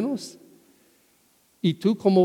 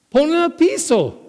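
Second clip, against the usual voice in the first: repeated much higher, pressing the point; emphatic repeat; 10 semitones higher, 3 dB louder than usual. Retold or imitated. Preacher repeating Jesus's command to the congregation, higher and insistent.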